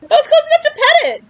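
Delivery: high, loud, squeaky gushing. Real, not acted. Gushing to viewers about petting the creature, high and squealing. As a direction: coo like you're talking to a puppy.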